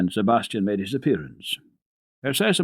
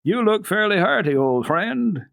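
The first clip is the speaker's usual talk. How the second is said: high, jovial teasing, slowing on well; hearty doctor; 8 semitones higher, 3 dB louder than usual. A narrator's reading. Doctor teasing his friend, high and jovial. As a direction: greet him like a laughing old friend. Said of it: "broke into a hearty laugh".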